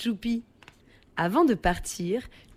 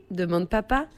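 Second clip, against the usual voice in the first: usual speaking voice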